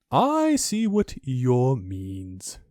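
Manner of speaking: very slow, ponderous owl voice. Acted. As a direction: weigh each word like a wise old bird. Owl's slow pompous voice.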